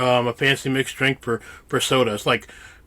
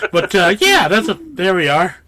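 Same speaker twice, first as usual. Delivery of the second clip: ordinary delivery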